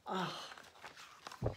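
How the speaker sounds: breathy unvoiced ugh of dismay; whispered groan. Groan of disgust.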